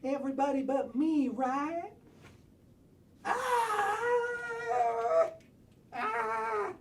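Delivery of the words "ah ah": high scream. Acted. Character screams.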